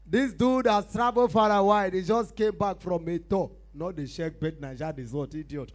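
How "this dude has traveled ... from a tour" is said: booming high-pitched show introduction; big loud MC announcement; event announcer. Acted. MC introducing a touring comedian to the crowd, booming announcer.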